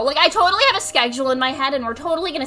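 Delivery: low, quiet, ironic promise; dry mock sincerity; deadpan sarcasm. Ironic claim of being organized.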